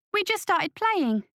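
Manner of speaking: put-on high, drawn-out child's whine; whining boy. A narrator's reading. Son pleading to keep playing, high slow whine. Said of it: "Christopher whined".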